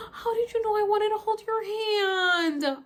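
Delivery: high, loud, amazed gush; retold delight. Reliving the moment he held his hand out, delighted high gush. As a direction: gush it high after a gasp.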